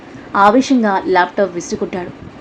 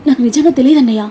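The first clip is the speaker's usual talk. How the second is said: anxious questions rising higher and louder; pleading sister, alarmed; worried sister asking her brother why she left, anxious; tense, worried; 4 semitones higher, 4 dB louder, faster than usual. A narrator's reading. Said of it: "అంది సాక్షి టెన్షన్గా చూస్తూ".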